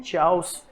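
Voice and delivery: चाउस with "ch" as in chair; wrong pronunciation. Shown as a demonstration. Teacher quoting how many of us misread chaos, चाउस. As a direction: say चाउस as "chows". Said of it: "गलत pronounce करते हैं".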